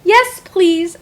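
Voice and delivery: high, loud eager yes; a happy child at the library saying yes. A narrator's reading. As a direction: answer with bright joy.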